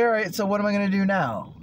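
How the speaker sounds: loud, high burst of self-talk; lively self-talk. Retold or imitated. Man asking himself what comes next, loud and lively.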